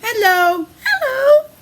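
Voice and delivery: bright high hello, rising on the second; greeting dog voice. Acted. Reading to a child, a dog greets another with a high hello. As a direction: call out a cheery dog greeting.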